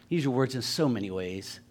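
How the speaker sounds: normal talking voice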